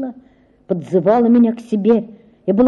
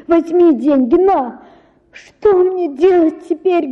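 high, desperate pleading; begging father to take the money; высокая отчаянная мольба; wringing hands in despair; 8 semitones higher than usual. Acted. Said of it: "ломая руки".

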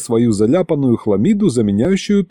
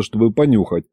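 plain reading voice

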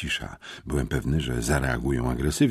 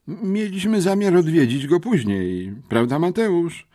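put-on raised, stammering plea of a scared boy; whining plea; 10 semitones higher, 5 dB louder than usual. A narrator's reading. Scared boy pleading his innocence, raised and stammering. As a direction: beg them to believe you, voice pitched up. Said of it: "jęknął Bernard Bartoś".

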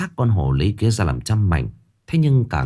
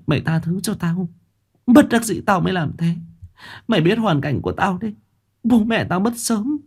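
high-pitched weepy begging; tearful pleading; 9 semitones higher than usual. A narrator's reading. Lam begging Chanh for forgiveness, tearful. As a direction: plead through tears, rising at the end. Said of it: "thụp xuống mà khóc".

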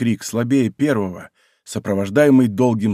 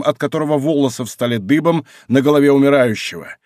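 ordinary delivery, nothing marked

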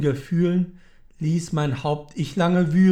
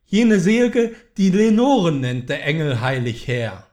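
higher, louder, rushing plea; desperate outcry; 5 semitones higher, 4 dB louder, faster than usual. Acted. The grieving man cries out at the raven, high and loud. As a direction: fling the questions at the bird in despair. Narrator begs raven about Lenore.

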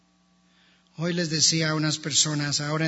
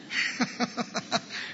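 soft, voiceless chuckle; a quiet laugh at his own joke; breathy laugh; 4 semitones higher, 8 dB quieter than usual. Real, not acted. Pastor chuckles softly at his joke about the Bible.

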